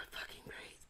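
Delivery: normal talking voice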